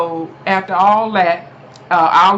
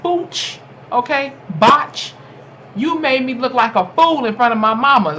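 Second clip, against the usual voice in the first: put-on angry kid voice; 3 semitones higher than usual. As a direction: vent like an offended boy. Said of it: "he probably wanted to say".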